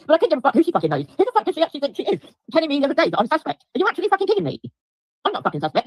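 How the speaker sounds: high, fast, furious ranting. Real, not acted. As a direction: spit it out fast and livid. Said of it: "I'm fucking furious at the moment".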